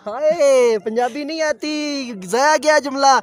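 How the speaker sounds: high, loud excited outburst. Real, not acted. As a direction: blurt it out high and fast.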